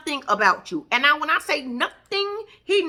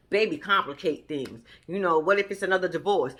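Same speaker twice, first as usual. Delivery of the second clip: mock; 7 semitones lower, 4 dB quieter than usual. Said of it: "mimicking his excuse".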